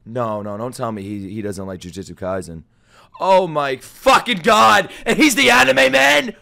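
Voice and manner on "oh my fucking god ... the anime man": slow, drawn-out high yelling; outraged disbelief. Real, not acted. Streamer appalled that anime man agrees, outraged shout. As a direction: stretch each word into an exasperated shout.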